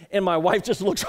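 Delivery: ordinary talk, nothing marked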